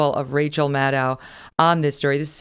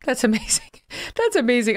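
high, laughing disbelief; amused amazement; 7 semitones higher than usual. Real, not acted. Host reacts to the Russia montage, amused amazement. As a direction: react with a laugh, pitched well up.

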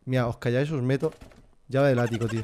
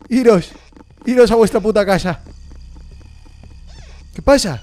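loud, higher frightened shouting; panicked yell; 7 semitones higher, 9 dB louder than usual. Real, not acted. Streamer yelling at creepy dolls to leave, panicked. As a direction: yell them away in a panic.

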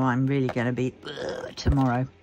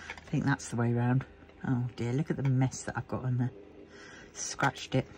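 muttering; 5 semitones lower, 7 dB quieter than usual. Real, not acted. Muttering while fixing a scratch.

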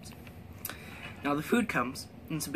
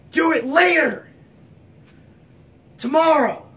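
Shia LaBeouf shouting; 5 semitones higher, 6 dB louder than usual. Retold or imitated. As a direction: scream like a manic life coach. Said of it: "a technique from my idol, Shia LaBeouf".

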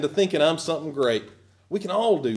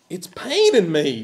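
normal talking voice

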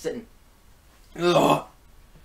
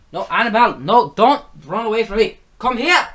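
raised, higher voice calling the cat back; 6 semitones higher, 9 dB louder than usual. Real, not acted. Vlogger calling the cat who runs away, loud and high.

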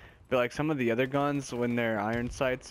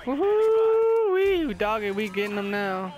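very high, loud whoop easing into eager talk; whooping cheer; 9 semitones higher, 5 dB louder than usual. Real, not acted. Gamer hyping his team's comeback, whooping. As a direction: cheer like the team finally turned it. Celebrating kills.

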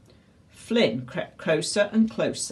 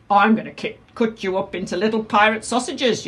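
loud, raised villain's threat; yelled threat; 5 semitones higher, 5 dB louder than usual. A narrator's reading. Tyrannosaurus threatens Captain Flynn, yelling. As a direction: yell it at the little pirate. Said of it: "yelled the Tyrannosaurus Rex".

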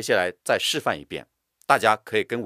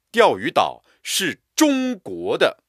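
中国 declared higher, loud and slow; stress on 中国; 7 semitones higher, 6 dB louder, slower than usual. Shown as a demonstration. Teacher declaring firmly, stress on 中国. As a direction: insist on 中国. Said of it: "为了强调是中国的".

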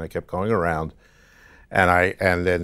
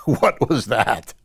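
drawn-out, higher-pitched astonishment; mock-stunned, slow and raised; amazed reaction; 5 semitones higher, 4 dB louder, much slower than usual. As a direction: stretch it out in retold disbelief. Retold waking from baffling dream.

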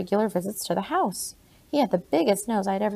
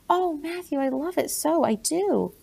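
delighted; 7 semitones higher than usual. A narrator's reading. Delighted at a gift.

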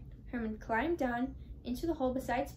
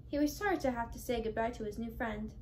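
plain reading voice

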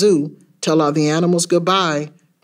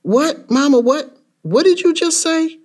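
high-pitched disbelieving questions; shocked child; 11 semitones higher than usual. Acted. Child hearing from Mama that Daddy died, shocked. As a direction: ask in stunned disbelief, voice shooting up.

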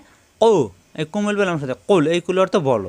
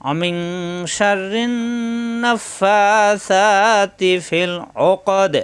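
very slow, higher melodic chant; Quran recitation; 3 semitones higher, much slower than usual. Acted. Teacher reciting the verse to students, slow melodic chant. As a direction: draw each syllable out in tilawat.

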